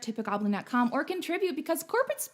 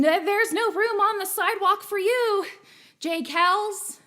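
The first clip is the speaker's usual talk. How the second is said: louder, higher hammed-up New York accent; 7 semitones higher, 4 dB louder than usual. Host teasing a chat viewer, mock New York accent. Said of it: "Am I doing the New York accent all right?".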